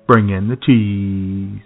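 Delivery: ordinary talk, nothing marked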